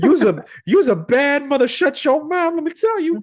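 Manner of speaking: high, loud hype voice; hyping up his buddy. Acted. Playful hype about Adam.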